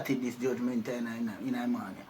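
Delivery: a lightly voiced ghost, low and fading; hint of a ghost talking low; eerie low ghost voice. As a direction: let the spirit murmur low from the dark. Ghost man speaks in the dark.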